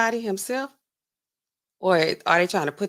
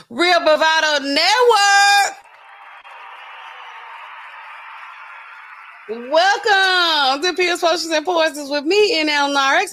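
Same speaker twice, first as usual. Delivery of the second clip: show host intro; 6 semitones higher, slower than usual. Acted. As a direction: announce the show grandly, stretching every word.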